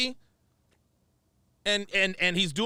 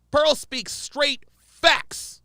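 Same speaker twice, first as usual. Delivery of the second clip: slow emphatic; 3 semitones higher, much slower than usual. Real, not acted. Slow emphatic statement of point.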